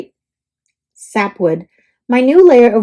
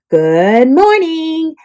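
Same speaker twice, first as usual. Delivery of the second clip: slow morning greeting; 4 semitones higher, much slower than usual. Acted.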